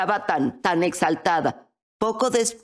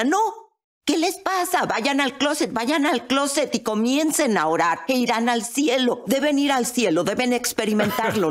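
high, excited urging; reenacted giddy exasperation, pitched up; frantic insistence; 6 semitones higher than usual. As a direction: plead with them to go right away. Frantically urging family to closet.

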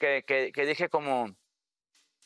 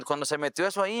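normal talking voice

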